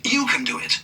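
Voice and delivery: mild encouraging conductor voice. A narrator's reading. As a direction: urge Thomas he can do it. Mister Conductor encourages Thomas.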